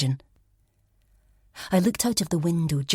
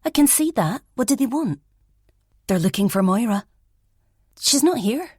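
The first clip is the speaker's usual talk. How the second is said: sharp flatmate; 8 semitones higher than usual. Acted. Elspeth on the landing to her flatmate, high and sharp. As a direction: snap back down the stairs at her.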